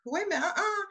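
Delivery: high, quiet put-on voice questioning the date; a squeaky doubting "wait a minute"; mock suspicious boyfriend. Twin's boyfriend catching on, high and hushed. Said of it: "they weren't gonna say".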